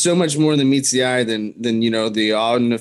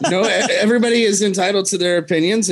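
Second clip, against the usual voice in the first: laughing; 6 semitones higher than usual. Real, not acted. Laughs off the teasing.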